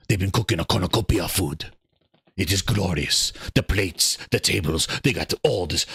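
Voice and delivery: low, deep voice of a showcase host giving a tour; mock showcase host. Streamer playing the showcase host, giving a kitchen tour in a deep voice. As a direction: play the smug host showing off the kitchen.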